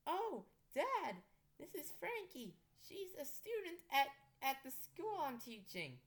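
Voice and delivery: high, slightly flustered explaining; lightly voiced self. Daughter introduces Frankie to dad, high and eager.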